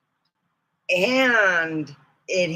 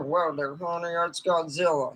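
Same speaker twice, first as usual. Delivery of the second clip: Western cowboy; 3 semitones lower, 5 dB quieter than usual. Shown as a demonstration. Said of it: "sounding like they were ready for a roundup".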